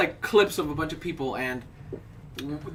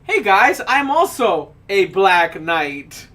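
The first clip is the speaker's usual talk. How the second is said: drawn-out, louder proud boast; 5 dB louder, much slower than usual. Retold or imitated. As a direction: announce it slowly and grandly. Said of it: "he's like".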